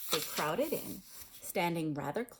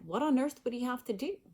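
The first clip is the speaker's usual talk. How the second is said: usual speaking voice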